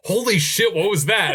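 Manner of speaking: high, laughing astonished outburst, as if just blindsided; a retold jolt of disbelief, pitched high; laughing mock shock. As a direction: act stunned with a laugh in it. Imagined viewer shocked at ending.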